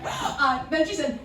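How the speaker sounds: usual speaking voice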